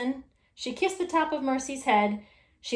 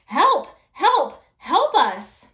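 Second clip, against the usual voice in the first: calling for help; 6 semitones higher, 7 dB louder, much slower than usual. A narrator's reading. Trapped couple calling out.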